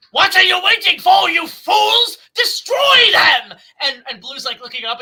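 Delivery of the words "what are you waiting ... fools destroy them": booming villainous monster shout, higher and louder; Indominus voice. Retold or imitated. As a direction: roar the command like a dinosaur tyrant. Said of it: "It's almost like it's saying".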